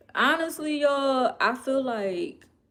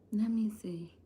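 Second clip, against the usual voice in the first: whispered to herself; quietly whispered aside; 6 dB quieter than usual. Real, not acted. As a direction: whisper it while looking something up. Muttering while checking phone.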